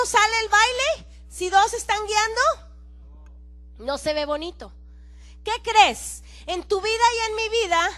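ordinary talk, nothing marked

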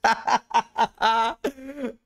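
warm giggle; delighted laugh. Real, not acted. Charmed by learner.

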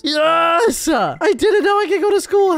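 excited. Acted. Overjoyed kid allowed to school.

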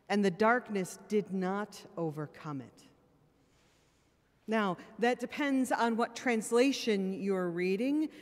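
ordinary delivery

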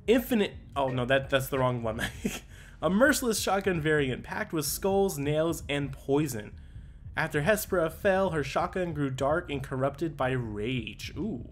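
usual speaking voice

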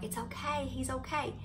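gently calm someone who is upset; hushed, retold comforting; imitating reassurance. Responders calming her, retold.

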